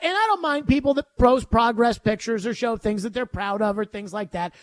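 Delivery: normal talking voice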